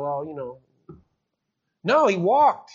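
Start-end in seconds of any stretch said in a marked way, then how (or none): 0.0-0.6 low, soft, noncommittal shrug; mock half-hearted. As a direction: imitate someone giving up halfway. Imitating a half-hearted response to healing.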